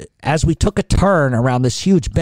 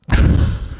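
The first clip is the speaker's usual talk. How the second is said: breathy laugh. Real, not acted. Laughs at his own denial.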